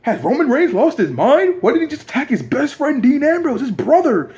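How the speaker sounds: high-pitched outraged disbelief; indignant commentator, voice pitched right up; JBL. Acted. Commentator questioning an attack on a best friend, incredulous. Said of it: "J B L is like".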